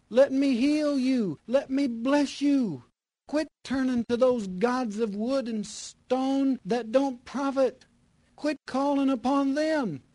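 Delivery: high, loud pleading preacher voice; preaching at full force. Acted. Preacher voicing God's plea to the people, pleading and loud. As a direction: cry it out as God calling sinners home.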